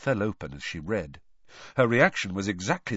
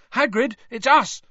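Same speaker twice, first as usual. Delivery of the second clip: higher, louder call through a door; calling to Hagrid at the door; 8 dB louder than usual. Acted. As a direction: shout to be heard inside.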